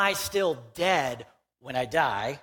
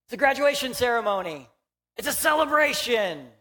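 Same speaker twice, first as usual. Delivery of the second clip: much higher, bright falsely delighted showing-off; mock cheerful denial; 7 semitones higher, 4 dB louder than usual. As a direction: chirp it like selling a dead thing as lovely. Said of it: "and we go".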